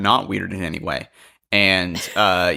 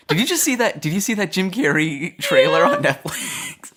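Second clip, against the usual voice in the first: higher, excited burst; 10 semitones higher than usual. Real, not acted. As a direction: blurt it out eagerly. Sudden excited tangent.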